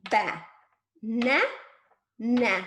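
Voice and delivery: loud, separate BA... NA... NA, long pauses between; finger-stretched syllables. Shown as a demonstration. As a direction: punch out BA, NA, NA one by one. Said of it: "finger stretch the word banana".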